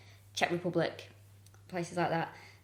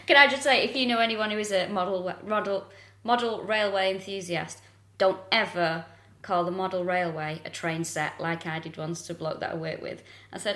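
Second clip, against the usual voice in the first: usual speaking voice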